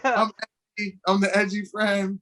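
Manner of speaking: high put-on sidekick voice; edgy best friend. Movie sidekick introducing herself, high put-on voice. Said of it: "She's the quirky best friend".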